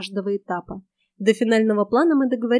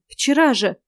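exasperated; 4 semitones higher, 5 dB louder, slower than usual. A narrator's reading. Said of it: "всплеснула руками я".

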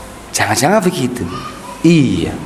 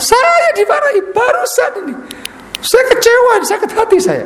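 hurt complainer. Acted. Student complaining to the ustaz about being scolded, high whiny voice.

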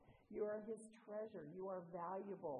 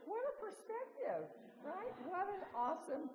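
much higher, laughing through the words; laughing still; 11 semitones higher, 3 dB louder than usual. Real, not acted. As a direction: squeeze it out mid-laugh.

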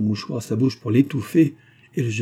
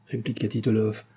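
plain reading voice

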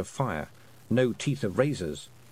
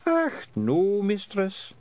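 high, gruff little Scottish warrior; Scottish pixie voice; 9 semitones higher, much slower than usual. Acted. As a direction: play a cheerful wee Scots fighter. Rob Anybody voice.

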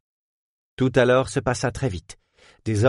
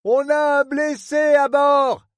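high, slow, carrying shout; shouting from the deck; 4 dB louder, slower than usual. Acted. Captain shouting to the quay about the injured man. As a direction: call it out over the wind.

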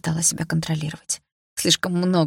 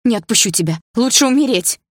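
desperate outburst; 6 semitones higher, 6 dB louder than usual. A narrator's reading.

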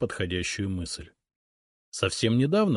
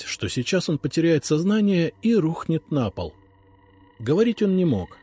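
usual speaking voice

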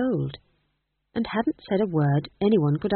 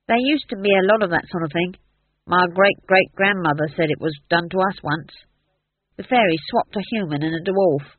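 lightly voiced gruff dwarf, chatty; a hint of a yokel dwarf telling tales. A narrator's reading. Dwarf character voice.

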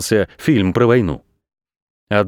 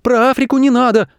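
put-on very high childlike yelp; high-pitched squeal of alarm; acted shriek; 12 semitones higher than usual. A narrator's reading. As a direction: cry out like startled kids. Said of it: "вскрикнули Саша и Паша".

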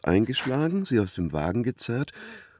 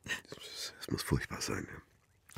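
hushed whisper; 9 semitones lower, 14 dB quieter, much slower than usual. Acted. Ermittler reagiert betroffen.

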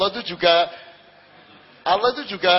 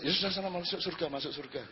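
dismissive brush-off; 10 dB quieter, faster than usual. Acted. God's dismissive answer.

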